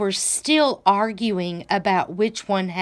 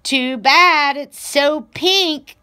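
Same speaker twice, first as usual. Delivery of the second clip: high, louder bray; braying donkey; 6 semitones higher, 5 dB louder than usual. A narrator's reading. Donkey sneering at Pig's pink tail, braying. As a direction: bray the insult out. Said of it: "brayed Donkey".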